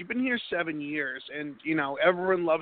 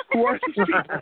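higher, louder baffled outburst; puzzled exclamation, played for laughs; mock bewilderment; 5 semitones higher, 5 dB louder than usual. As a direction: act comically baffled by these strangers. Mock bewilderment at hometown accents.

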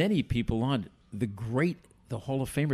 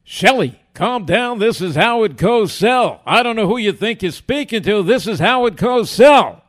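loud, raised, indignant sportscaster impression; Howard Cosell; 8 semitones higher, 12 dB louder than usual. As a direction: declaim it forcefully like an affronted broadcaster. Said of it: "Howard's going".

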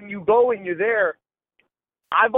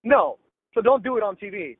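usual speaking voice

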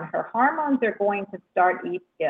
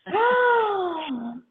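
delighted gasp; 11 semitones higher than usual. Real, not acted. Delighted reaction to caller.

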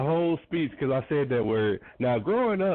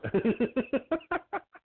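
high laugh; 13 semitones higher than usual. Real, not acted. Host laughs at guest's rant.

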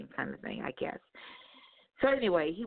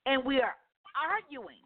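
usual speaking voice

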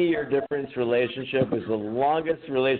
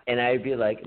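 usual speaking voice

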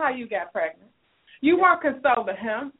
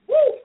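sudden high, loud "Woo!"; a real, loud, high whoop; exclaiming woo; 12 semitones higher, 6 dB louder than usual. Real, not acted. Host reacting to the caller's hypocrisy.

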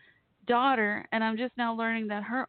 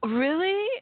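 surprised; 8 semitones higher, 5 dB louder than usual. Real, not acted. Surprised by listener's comment.

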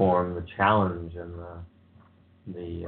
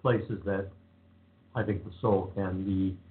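ordinary talk

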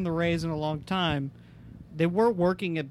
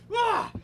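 emotional grunt. Retold or imitated. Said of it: "throwing that fastball, like".

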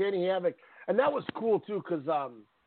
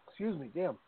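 choking on a drink; 9 dB quieter than usual. Real, not acted. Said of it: "I'm choking or something over here".

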